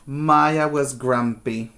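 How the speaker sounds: put-on lively read-aloud to kids; picture-book reading voice; story narration. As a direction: read it like storytime, big and expressive.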